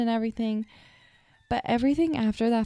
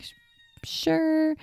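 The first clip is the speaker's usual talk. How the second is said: flustered sure; 8 semitones higher than usual. Retold or imitated. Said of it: "So I'm like".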